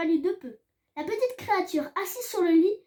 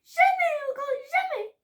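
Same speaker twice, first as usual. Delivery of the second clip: high elf cry, fading; the elf weeps over the kindness; tearful high elf voice; sobbing elf; 10 semitones higher than usual. A narrator's reading.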